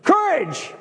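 high, emphatic mock yell; exclaimed yell, pitched high and falling. Shown as a demonstration. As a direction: call it out like an exclamation. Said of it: "It's yelling".

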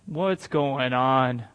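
low, slow, bewildered question; lightly acted low confusion; confused old father. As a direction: draw it out slowly, playing a puzzled old man. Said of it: "he's very confused".

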